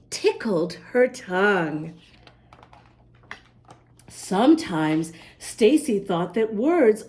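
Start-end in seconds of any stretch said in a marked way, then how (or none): none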